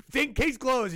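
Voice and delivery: high, loud case closed; smug mock triumph. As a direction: toss it off like a smug genius. Hacker wrapping up case.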